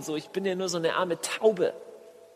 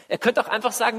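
normal talking voice

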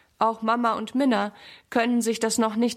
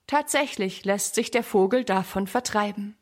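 ordinary delivery

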